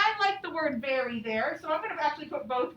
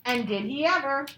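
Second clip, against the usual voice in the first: slow, clear storybook reading; patient read-aloud to young pupils; reading to children; 8 dB louder, much slower than usual. A narrator's reading. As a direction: read it out slowly for the class.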